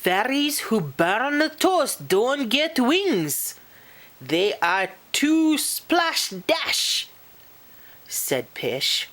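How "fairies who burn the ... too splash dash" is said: high-pitched, fussy telling-off; prim little fairy laying down rules; scolding fairy. Acted. Pish scolds Posh.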